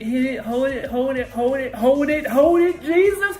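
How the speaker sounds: building from urgent pleas to high screaming begging; excited pleading. Real, not acted. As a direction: beg the chart to hold, rising to a shriek. Tense trade nearing target.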